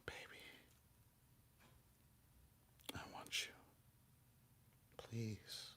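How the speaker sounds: breathy whispered plea; lusty whisper. Retold or imitated. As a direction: whisper it like a needy lover. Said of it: "saying it all lustily".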